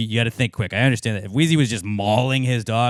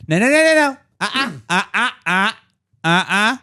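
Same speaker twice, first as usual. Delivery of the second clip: put-on high, loud dad correcting a toddler; head off the mischief with quick scolding nos; dad mode; 9 semitones higher, 4 dB louder than usual. Dad telling kids what not to put in the bath, scolding. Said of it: "I was just in dad mode".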